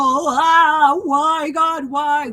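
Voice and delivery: mock self-pity wail. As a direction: moan to God like a martyr, half in jest. Mocking self-pity in suffering.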